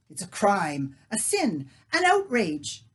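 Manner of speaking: loud outraged protest, rising. Acted. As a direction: spit out each word in outrage. Leah outraged.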